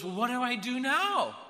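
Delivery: high, loud, excited asking; urgent question, pitched right up; eager retold question. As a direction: act out someone bursting to know the next step. Retold man eager to be saved.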